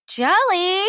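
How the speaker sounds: put-on high, loud whiny girl; whined. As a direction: whine it like a jealous kid. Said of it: "whined Farah".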